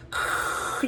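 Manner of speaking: rasping voiceless static noise; imitated TV static. Showing how the creatures talked, imitated static. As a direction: make the hiss of an untuned TV. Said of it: "It's like static".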